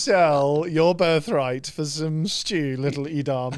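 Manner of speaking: slow British accent mocking a little boy. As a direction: sneer it in a cruel British accent. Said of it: "in a British accent".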